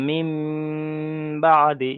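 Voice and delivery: "মিম" held long in a nasal hum before "বাদি"; ghunnah demonstration. Shown as a demonstration. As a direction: hum the final m of "মিম", then release firmly into "বাদি". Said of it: "একালি পরিমাণ গণ্যা সহ পড়তে হবে".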